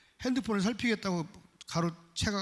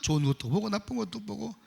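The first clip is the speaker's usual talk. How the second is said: ordinary talk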